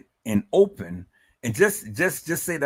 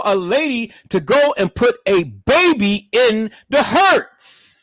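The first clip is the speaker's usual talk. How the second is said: high, loud, demanding questions; real irritation; 12 semitones higher, 7 dB louder than usual. Real, not acted. Vlogger demanding answers from viewers, pitch up high and loud. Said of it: "I'm getting very irritated".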